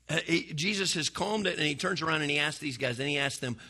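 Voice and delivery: ordinary talk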